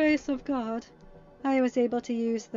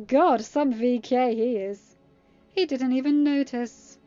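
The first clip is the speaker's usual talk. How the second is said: higher, louder mocking boast; smug sneer; 3 semitones higher, 7 dB louder than usual. A narrator's reading. Captive mocking the guard he slipped past, smug. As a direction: brag and sneer with relish. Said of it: "Freddy smirked".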